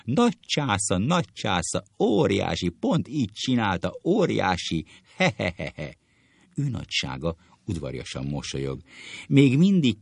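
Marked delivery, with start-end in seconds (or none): 0.0-6.0 hammed-up guffaws, high at first; acted helpless hilarity; bursting with laughter. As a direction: gasp out praise between mock cackles. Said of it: "Majd megpukkad".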